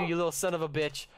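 ordinary talk, nothing marked

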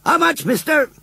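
high-pitched lad's shout. A narrator's reading. Group of lads calls to the farmer, shouting. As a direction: call out high from the crowd. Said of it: "a shout went up".

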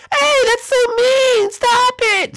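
very high, loud protest; high-pitched hurt outcry; indignant squeaky character. Acted. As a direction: scold in a tiny piercing voice. Figure protests at an insult.

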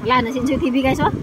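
talking between bites; mouth full, chewing. Real, not acted. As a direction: hand over mouth while eating. Seen 4: Hand at mouth with food until 0.9s, then hand moves to forehead.

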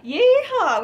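high, very loud cowboy holler; cowboy whoop. Pretend. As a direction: let rip a rodeo whoop. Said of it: "We got a cowboy in here".

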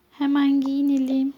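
ordinary talk

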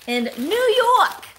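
loud, high, drawn-out mock New York accent; badly faked New York accent, stretched out; horrible New York accent. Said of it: "That's a horrible, a horrible accent".

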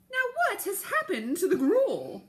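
put-on high, loud, alarmed old woman; old woman scolding. A narrator's reading. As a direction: demand an answer, shocked. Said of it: "she said to the cat".

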